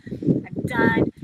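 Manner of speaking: high, loud, furious cry of I'm done; fed-up shout. Retold or imitated. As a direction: yell it like you've hit your limit. Cursing God, fed up.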